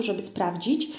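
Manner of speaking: ordinary delivery, nothing marked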